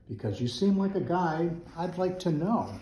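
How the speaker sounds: high, squawky crow voice; squawking crow. Acted. Crow squawking at the buffalo, high and squawky. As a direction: squawk it out like a mangy old crow. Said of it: "squawked the mangy old crow".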